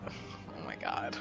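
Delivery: usual speaking voice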